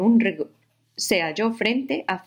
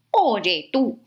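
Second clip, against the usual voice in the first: grouchy ladybug; 4 semitones higher than usual. Acted. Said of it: "le dijo la mariquita malhumorada".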